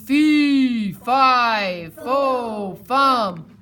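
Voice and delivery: loud booming giant chant. Acted. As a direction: boom out the rhyme like a giant. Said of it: "Jack heard a booming voice".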